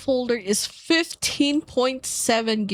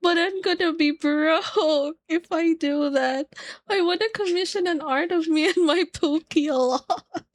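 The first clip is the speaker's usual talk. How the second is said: whiny; 7 semitones higher than usual. Retold or imitated. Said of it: "And I'm like".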